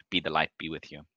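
softly murmured blessing; temple clerk's blessing. Acted. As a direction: give a quiet, low blessing as a temple clerk. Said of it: "and goes".

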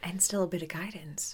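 soft whispered aside. Real, not acted. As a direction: breathe it quietly. Quiet interjection whisper.

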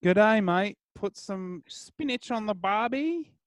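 hammed-up Australian accent, high and slow at the end. Shown as a demonstration. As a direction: have another go at an Australian accent, lilting upward. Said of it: "when I do the Australian accent".

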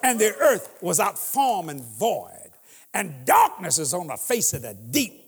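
scripture read, deep and measured; booming biblical narration. Acted. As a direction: intone it like Genesis. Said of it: "and I quote scripture".

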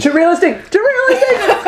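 high-pitched playful protest; mock alarm. Player protesting the vivid branding description, mock alarm. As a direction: squeal it in mock panic.

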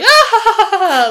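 high, loud mock cackle; exaggerated fake laugh of relief; imitated laughter. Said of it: "then they start laughing".

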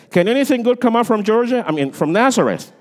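high, loud, fast scoffing question; mock scornful exclaim. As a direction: scoff like a doubter, hammed up. Repeated joke quote.

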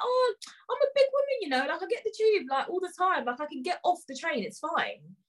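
high-pitched retold protest; indignant retort. Passenger insisting she can get off the train, indignant. Acts out own protest to him.

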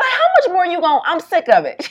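mock exasperated friend.

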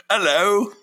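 high, loud, hammed-up British hello; a silly British voice actor's hello; mock British trooper. Voice actor's first line as trooper, hammed-up British. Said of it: "you're doing their voices".

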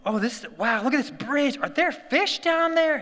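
high-pitched imitation of an amazed small child; over-the-top wonder. Little son marvelling at a bridge, imitated over-the-top wonder. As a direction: gush like a thrilled toddler, drawing out the end. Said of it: "just over the top".

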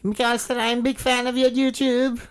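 put-on high squeaky young boy voice, eager and loud; squeaky kid gamer. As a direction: play an overexcited twelve-year-old fan. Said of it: "it's fucking screeching through my ears".

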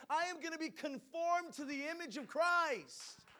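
loud, high-pitched fiery preaching; booming motivational speech. Acted. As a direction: preach it out to the whole church. Preaching at full force.

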